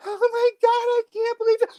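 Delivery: very high falsetto gushing; [name]'s high voice. Acted. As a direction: imitate a sister squeaking in girlish disbelief. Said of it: "We'll go from".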